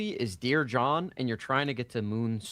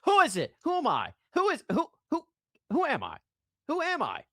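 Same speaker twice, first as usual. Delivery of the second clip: high, sing-song who am I; playful teasing; 12 semitones higher, faster than usual. Pretend. Streamer quizzing viewers on his identity, playful and high. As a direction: tease the audience to guess, pitched up. Teasing chat guessing game.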